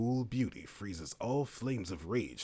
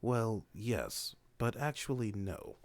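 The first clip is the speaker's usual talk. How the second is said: ordinary talk, nothing marked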